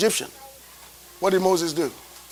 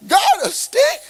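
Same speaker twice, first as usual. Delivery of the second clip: Moses can't believe he gets only a stick; incredulous shout; 12 semitones higher than usual. Acted. Moses to God, high shocked shout.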